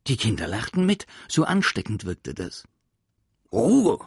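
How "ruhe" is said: much higher and louder, a barked order to the class; furious shout. Acted. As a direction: yell Ruhe like an enraged teacher. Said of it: "schrie Groll wütend".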